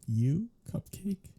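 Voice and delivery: laugh, then push Cupcake up high in scorn; mocking disbelief. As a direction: scoff at the idea, pitch jumping up on the name. Mocking the hunter's cryptic answer.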